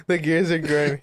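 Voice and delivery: usual speaking voice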